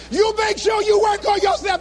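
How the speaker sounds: high, comic near-tears preaching; heartbroken speechifying. Retold or imitated. Dumped speaker back before cheering crowd, heartbroken. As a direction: push the speech out through mock heartbreak.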